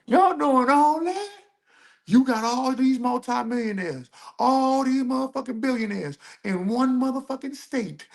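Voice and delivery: high-pitched disbelief, drawn out on key words; incredulous outburst. Real, not acted. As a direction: push the voice way up in protest.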